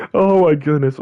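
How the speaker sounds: usual speaking voice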